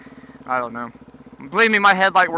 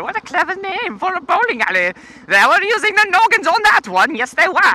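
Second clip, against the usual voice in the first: sarcastic high; 11 semitones higher than usual. Acted. Sarcastic about bowling alley.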